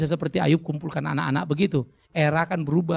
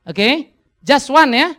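loud, higher "Just one ya"; emphatic urging; 9 semitones higher, 11 dB louder, much slower than usual. Real, not acted. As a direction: press the point hard on the congregation. Pastor urges wives: just one.

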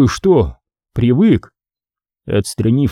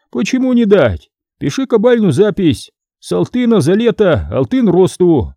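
much higher, brisk lender's voice; eager merchant; 7 semitones higher than usual. A narrator's reading. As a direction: offer the loan with a trader's glee.

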